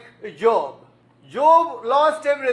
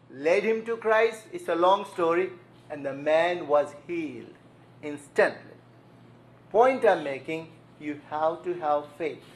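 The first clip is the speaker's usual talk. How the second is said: usual speaking voice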